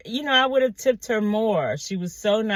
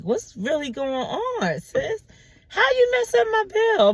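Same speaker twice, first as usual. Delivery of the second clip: sassy outrage; 12 semitones higher than usual. Retold or imitated. Telling the waitress off in her head, high and sassy. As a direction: climb into a squeaky, sassy how-dare-you. Confronting waitress over wrong bill.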